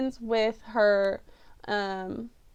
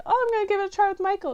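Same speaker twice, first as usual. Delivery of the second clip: a higher, quick, breezy take on the boy, lightly voiced; mocking Poe; 8 semitones higher, much faster than usual. Reviewer parodying Poe's throwaway movie line, a light higher mock voice. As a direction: toss it off like it's nothing.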